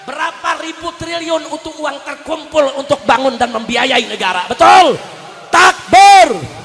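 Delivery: loud oratory building to a shout; full-force preaching. Real, not acted. Preacher rallying the crowd over tax money, shouted preaching. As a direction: drive the crowd up to a roar.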